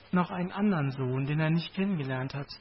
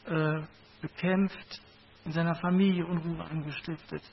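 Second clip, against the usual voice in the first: ordinary talk, nothing marked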